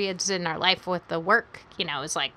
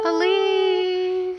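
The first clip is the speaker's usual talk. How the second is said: higher drawn-out call; calling from far away; 9 semitones higher, 4 dB louder than usual. Pretend. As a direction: stretch the name, searching. Calling out in the dark.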